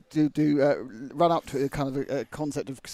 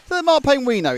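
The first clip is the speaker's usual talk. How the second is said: high, loud mock commentary voice; mock commentator; 12 semitones higher, 10 dB louder than usual. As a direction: parody the commentator. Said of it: "he says to the world".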